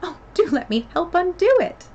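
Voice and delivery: high, loud eager offer; keen, helpful Alice; anxious to help. A narrator's reading. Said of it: "looking anxiously about here".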